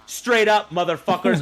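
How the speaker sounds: high, loud put-on settler rant; mock colonist rant. Acting a colonist with a musket.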